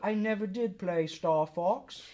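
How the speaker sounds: a hint of British accent, slow. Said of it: "doing my best British accent".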